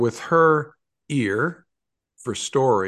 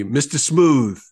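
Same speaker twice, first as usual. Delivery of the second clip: a touch of a booming New York cop; booming Brooklyn man; 3 semitones higher, 6 dB louder, slower than usual. Retold or imitated. Old friend booms a nickname at me, imitated booming Brooklyn voice. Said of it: "he would boom at me".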